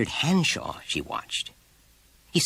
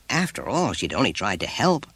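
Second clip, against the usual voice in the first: plain reading voice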